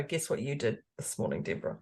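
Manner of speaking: low, soft mocking of her cohost; lightly voiced sly dig; teasing dig. Mimicking Moira's dig at her, low and soft. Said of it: "threw a dig at me to go".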